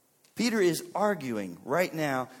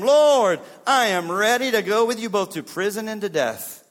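loud, higher, forceful vow; bold declaration; 5 semitones higher, 9 dB louder than usual. Acted. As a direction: proclaim Peter's vow at full volume. Peter's bold vow acted out.

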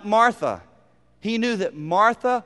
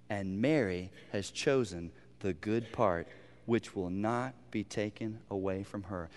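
soft, low and tender, a lot softer; gentle loving rebuke; 10 semitones lower, 11 dB quieter than usual. Acted. Jesus gently rebuking a busy Martha, soft and tender. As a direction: correct her kindly, almost hushed. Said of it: "A very gentle, loving rebuke".

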